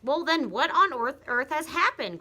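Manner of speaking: put-on high, loud frantic old wife; desperate demand; wife crying out in confusion; crying out Mrs Twit. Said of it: "cried Missus Twit".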